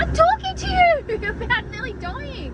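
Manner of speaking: high-pitched incredulous rant; rattled, half laughing at the scare; rattled disbelief. Real, not acted.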